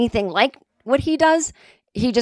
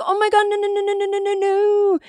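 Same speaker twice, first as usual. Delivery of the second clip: very high, rapid string of no's; frantic denial; 13 semitones higher than usual. Retold or imitated. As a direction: wave off his apology in a panic. Frantic denial of his apology.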